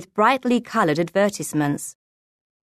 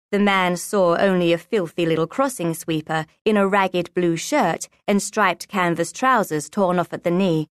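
usual speaking voice

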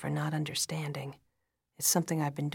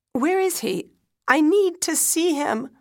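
high, loud desperate begging; 11 semitones higher, 11 dB louder than usual. A narrator's reading. Wife begging doctor to see dead husband, desperate. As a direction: beg frantically to see him. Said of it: "I beg".

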